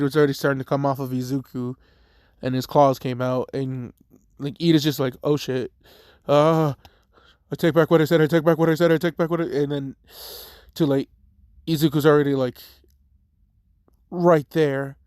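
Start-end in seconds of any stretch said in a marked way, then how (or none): 5.2-9.4 loud, high, frantic take-back; panicked backpedaling. Retold or imitated. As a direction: blurt it out in a panic. Ida panics before Izuku attacks.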